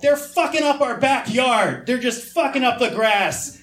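confused dad complaining. Retold or imitated. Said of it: "He's like".

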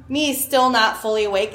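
normal talking voice

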